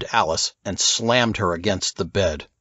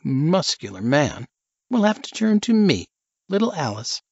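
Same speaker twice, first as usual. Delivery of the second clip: put-on higher, coy, scheming young woman; 7 semitones higher than usual.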